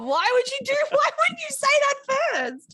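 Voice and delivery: high, laughing disbelief; laughing protest. Real, not acted. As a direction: squeal it through laughter. Exasperated after spoiling film.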